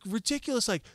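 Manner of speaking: ordinary delivery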